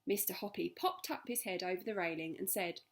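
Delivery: ordinary delivery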